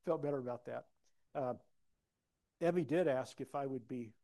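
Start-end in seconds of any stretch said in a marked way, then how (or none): none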